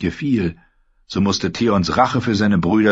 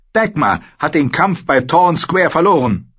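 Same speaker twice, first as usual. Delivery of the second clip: put-on higher, louder young man bursting out; raised, agitated young lord; Theon blurting news; 10 semitones higher, 4 dB louder than usual. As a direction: blurt the defeat out in a rush.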